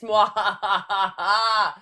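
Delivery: evil cackle. Pretend. Villain's gloating laugh.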